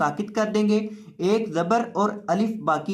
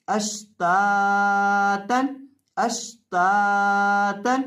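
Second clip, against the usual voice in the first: अश्तातन read very slowly with each syllable held; drawn-out reading; much slower than usual. Shown as a demonstration. Teacher showing students the whole word read slowly. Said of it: "ता दो ज़बर तन".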